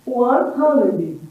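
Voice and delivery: "a hundred" and "one hundred" said slowly and clearly; slow model words. Shown as a demonstration. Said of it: "como é que eu falo cem em inglês". ("one hundred" pronunciado devagar)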